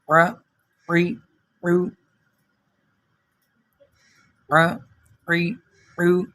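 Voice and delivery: ع، عی، عو squeezed from the throat; short separate syllables, each ع throaty; ain throat sound. Shown as a demonstration. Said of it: "عین کی آواز کو نکالنا ہے". (حلق سے عین کی آواز)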